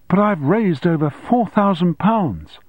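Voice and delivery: high, slow protest. A narrator's reading. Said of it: "he repeated out loud again and again".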